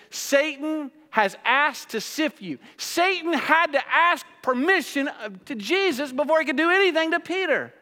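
high, loud, ringing preaching; full-force preaching. Acted. Preacher telling the congregation about Peter, at full force. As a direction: proclaim it to the back row.

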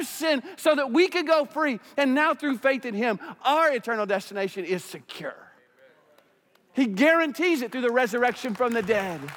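high, loud fired-up preaching; preaching at full force. Acted. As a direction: lift the room, preach it hard.

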